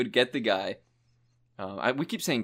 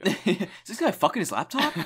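higher, laughing through the words; laughing mock question; 9 semitones higher, 4 dB louder than usual. Friend laughing while voicing the trash man's question. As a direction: crack up while asking.

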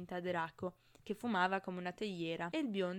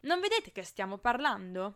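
loud, indignant protest; shouting; 9 dB louder than usual. A narrator's reading. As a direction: raise your voice in exasperation. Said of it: "urlò bloccando il via vai". (a voce alta, indignato)

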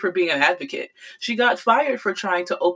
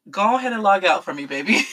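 quick dismissal; faster than usual. Real, not acted. Telling him to log off.

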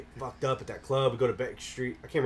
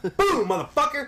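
triumphant shout; 14 semitones higher, 5 dB louder than usual. Retold or imitated. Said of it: "I said".

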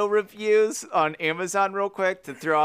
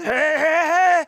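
loud, pulsing cartoon laugh; high, loud put-on chortle; Fat Albert laugh; 9 semitones higher, 6 dB louder than usual. Shown as a demonstration. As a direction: belt out a cartoon catchphrase laugh. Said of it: "It's almost like Fat Albert".

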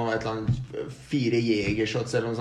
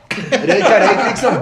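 laughing; 6 semitones higher, 10 dB louder, slower than usual. Retold or imitated. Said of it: "Så ler jeg bare".

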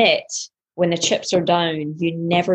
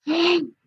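sharp gasp; 12 semitones higher than usual. Retold or imitated. Retelling the rush of emotion.

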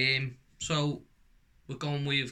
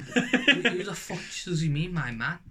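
mock bewilderment, pitched up; baffled; 5 semitones higher, 3 dB louder than usual. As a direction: retell it in puzzled disbelief. Baffled retelling of a mix-up.

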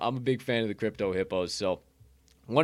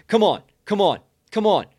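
higher and louder come on; hyped urging; 7 semitones higher, 8 dB louder than usual. Real, not acted. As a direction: pump the viewers up.

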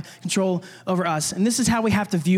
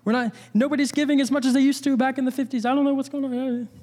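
fast, higher voice of fretting angels; panicky angels; 4 semitones higher, faster than usual. Retold or imitated. Preacher mocking angels freaking out, fast and high. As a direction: play a flustered angel fearing layoffs. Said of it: "all the angels are freaking out".

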